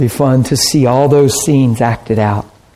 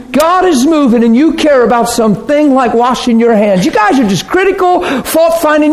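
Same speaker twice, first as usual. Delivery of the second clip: indignant scolding; 8 semitones higher than usual. Acted. Preacher acts out berating the religious fault-finders, indignant. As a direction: hammer them with mock outrage. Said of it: "it would be easy in the mind of man to say".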